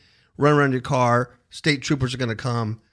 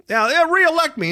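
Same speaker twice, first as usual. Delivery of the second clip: mocking politician voice; 7 dB louder than usual. Mocking the governor candidate.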